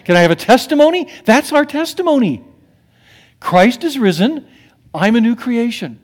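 hammed-up revival shout; Southern preacher call. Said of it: "how they say it down in Mississippi".

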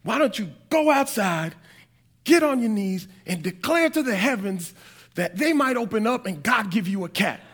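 a sister quoting herself, voice lifted; sister voicing herself. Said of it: "I said".

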